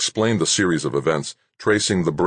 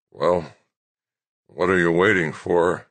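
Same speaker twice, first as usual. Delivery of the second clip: cranky slurred croak; 2 semitones higher than usual. A narrator's reading. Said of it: "in his cranky, slurred croak of a voice".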